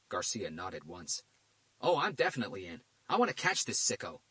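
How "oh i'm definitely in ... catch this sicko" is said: a pushy detective, voice pitched higher and louder; Garcia's voice. A narrator's reading. Detective Garcia demanding answers from the FBI director, pushy.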